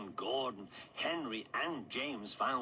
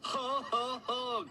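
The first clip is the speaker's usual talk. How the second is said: high, hooting mock belly laugh; guffaw; 13 semitones higher, slower than usual. A narrator's reading. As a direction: guffaw in big round ho-hos. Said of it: "guffawed the cars".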